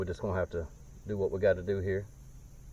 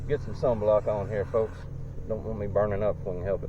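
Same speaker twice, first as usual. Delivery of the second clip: talking around a cigarette in mouth. Real, not acted. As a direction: lips holding a lit cigarette. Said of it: "have a cigarette in my mouth while I'm spraying this".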